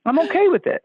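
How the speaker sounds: high, serene repeat; light mock carefree self-quote; retold reply. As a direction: lilt it calmly, high up. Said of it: "And I said".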